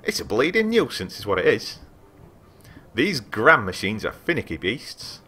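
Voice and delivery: lightly voiced grumpy Yorkshire artificer; grumbling Yorkshire. Player redoes the artificer's complaint in Yorkshire accent. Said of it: "in a thick Yorkshire accent".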